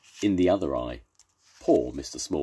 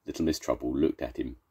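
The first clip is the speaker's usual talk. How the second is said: ordinary delivery, nothing marked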